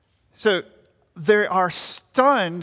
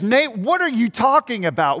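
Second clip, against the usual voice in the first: mock interruption from the pews, lightly raised; outburst; 4 semitones higher, 5 dB louder than usual. A congregant blurting out at the preacher, mock outburst. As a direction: pretend heckler's outburst, a bit louder. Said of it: "this sort of Pentecostal outburst".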